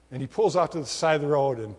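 ordinary talk

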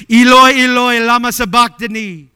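high, anguished cry to God; acted cry of the forsaken, very loud. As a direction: cry it out at full voice. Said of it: "Jesus cried out in a loud voice".

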